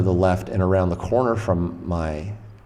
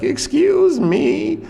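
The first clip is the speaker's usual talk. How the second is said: very high, drawn-out, eerie call; strangest voice; 5 dB louder, much slower than usual. Retold or imitated. Bleeding stranger calling in at an open door, strangest voice. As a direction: stretch it out slowly in a loud, strange high voice. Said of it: "he's got the strangest voice".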